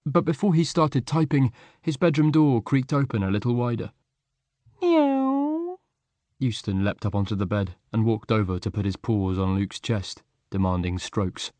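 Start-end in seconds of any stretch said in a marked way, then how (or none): 4.8-5.8 high cat mew; cat meow. A narrator's reading. Cat enters the room.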